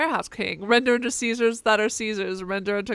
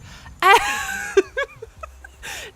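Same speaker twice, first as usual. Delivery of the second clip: big high burst of laughter; laughing; 6 dB louder than usual. Real, not acted. Streamer cracking up at the game's pun, high and loud. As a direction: crack up loudly.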